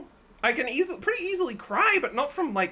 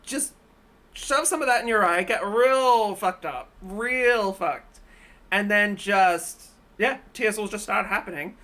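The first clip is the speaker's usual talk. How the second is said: ordinary talk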